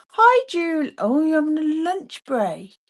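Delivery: calling out, delighted to spot her; excited greeting. Real, not acted. Greeting a friend on her lunch break, excited. Greeting someone seen on chat.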